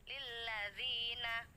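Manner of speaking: slow chanted tilawah; Quran recitation. Shown as a demonstration. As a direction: recite with a melodic, stretched tune. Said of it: "huruf mat yang tidak bertemu hamzah".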